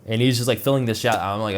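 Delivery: ordinary talk, nothing marked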